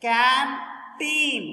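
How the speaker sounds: put-on "Canteen" drawn out as can, pause, teen; syllable by syllable. Shown as a demonstration. Teacher counting syllables for students, "Canteen" split in two. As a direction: stretch "Canteen" into two separate beats. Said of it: "Two syllable".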